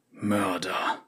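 soft low hiss. Acted. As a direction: spit the word out quietly. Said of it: "zischte er".

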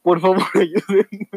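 mock pleading. Imitating the friend's pleading audio.

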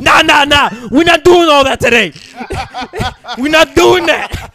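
loud, high call to stop the yelling; loud shutdown. Retold or imitated. Dominican guy on the train shutting down a yeller, loud. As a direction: shut the crazy man down, loud and firm. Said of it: "Dominican dude be like".

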